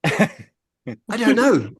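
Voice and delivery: very slow, high and laughing through it; laughing child. Retold or imitated. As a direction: drawl out a giggling, stumped kid's reply. Grandson stumped at bedtime.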